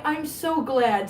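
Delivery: ordinary talk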